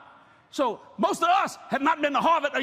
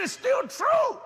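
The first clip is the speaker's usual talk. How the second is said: insistent shout; 12 semitones higher, much slower than usual. Retold or imitated. Preacher insists to the man.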